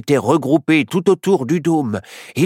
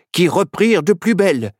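ordinary delivery

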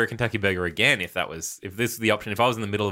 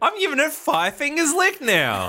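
mock enthusiastic; 4 dB louder, slower than usual. Joking high rating voice.

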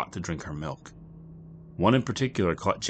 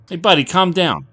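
raised, urgent bartender voice; calling out; 13 semitones higher, 12 dB louder than usual. A narrator's reading. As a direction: call over the row, high and loud. Bartender calls out to raging Jack.

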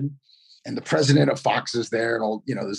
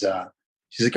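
usual speaking voice

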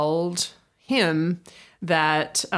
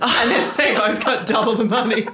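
laughing; 3 semitones higher, 4 dB louder than usual. Real, not acted. Excited about the payout story.

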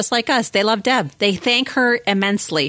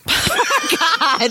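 cracking up on one word; stifled giggles; 8 semitones higher than usual. Real, not acted. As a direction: giggle breaks through. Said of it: "I'm trying not to laugh".

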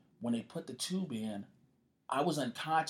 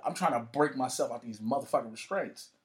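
usual speaking voice

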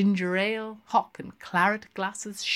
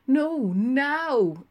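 urging; 7 semitones higher, 4 dB louder than usual. A narrator's reading. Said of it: "urged Lenehan".

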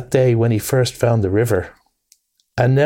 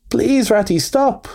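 high, pleading cry; anguished plea; 11 semitones higher, slower than usual. A narrator's reading. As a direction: beg a friend to stop, heart aching. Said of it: "pleaded the poor mole, in anguish".

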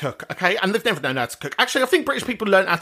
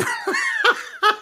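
long, high-pitched run of laughter. Real, not acted. Comic cracks up at her own pasta joke, high laughter.

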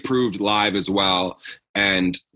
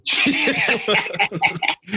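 long, high burst of laughter; helpless high laughing; 9 semitones higher than usual. Real, not acted. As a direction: crack up at the joke. Cracks up at co-host's joke.

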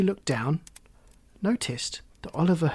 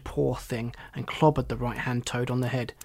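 plain reading voice

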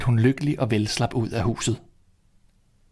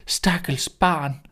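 put-on old woman voice; 5 semitones higher, much slower than usual. Old woman pities the girl.

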